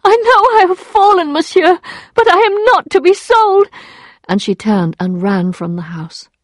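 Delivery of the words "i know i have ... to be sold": very high, loud outcry; anguished cry. A narrator's reading. Emma recoils from the lawyer, anguished cry. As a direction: cry out proudly, pitched right up.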